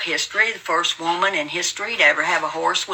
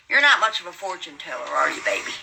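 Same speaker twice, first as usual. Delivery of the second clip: normal talking voice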